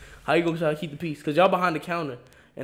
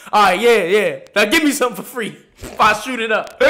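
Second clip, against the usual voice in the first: loud, higher hammed-up threat; mock threat; 6 semitones higher, 12 dB louder than usual. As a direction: play the cocky prankster. Retold prankster threatening.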